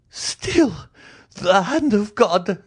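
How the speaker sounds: high, slow cry of despair; anguished cry. A narrator's reading. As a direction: cry it to the heavens, high and drawn out. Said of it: "and he cried".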